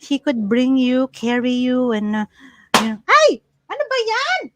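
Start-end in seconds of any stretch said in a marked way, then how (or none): 3.1-4.4 startled yelp. Real, not acted. Startled by daughter during livestream.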